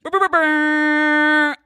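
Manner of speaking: slow, high, buzzing air horn mouth-noise; hype-girl air horn imitation done by mouth; imitated air horn. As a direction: blast it out like an air horn at a party. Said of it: "we need some air horns".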